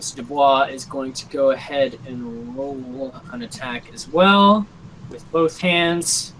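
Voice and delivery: ordinary talk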